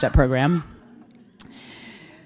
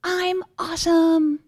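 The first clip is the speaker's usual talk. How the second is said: tender reassurance; 10 semitones higher, much slower than usual. Retold or imitated. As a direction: comfort her gently and slowly. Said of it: "and I go".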